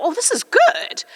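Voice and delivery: put-on high, laughing childlike voice; naive delighted thought. Young girl delighted by her grandmother's photo, laughing. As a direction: chuckle it out as a pleased thirteen-year-old. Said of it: "and I thought".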